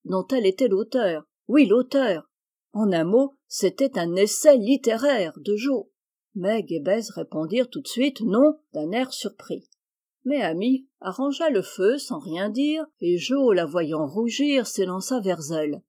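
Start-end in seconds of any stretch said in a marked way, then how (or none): none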